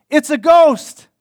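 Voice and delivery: loud, high acted cry of alarm; frightened cry. Disciples seeing Jesus walk on water, crying out in fear. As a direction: cry it out in mock terror. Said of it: "they cried out".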